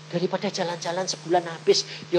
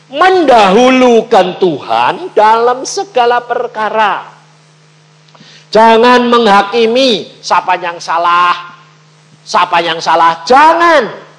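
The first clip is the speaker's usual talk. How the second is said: loud, slow preacher's exhortation; slow, loud pulpit call; full-force preaching; 6 semitones higher, 12 dB louder than usual. Acted. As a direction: drive the point home to the congregation.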